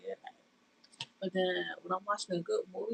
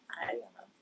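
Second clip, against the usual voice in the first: soft whispered hi, lower; whispered greeting; 13 semitones lower, 6 dB quieter than usual. Real, not acted. Greeting her live viewers in a whisper.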